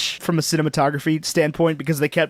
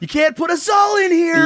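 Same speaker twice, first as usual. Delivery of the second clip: loud, high, shouted protest; defiant shout; 13 semitones higher, 4 dB louder than usual. Retold or imitated. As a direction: shout it at the arena. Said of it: "she's just like".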